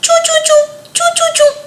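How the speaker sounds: very high tiny chirping; baby bird chirp. Acted. The lost chick chirps again, very high. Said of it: "फिर आवाज़ आई".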